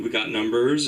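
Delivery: ordinary talk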